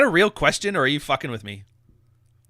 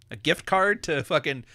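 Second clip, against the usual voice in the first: ordinary talk, nothing marked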